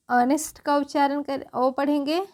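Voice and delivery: normal talking voice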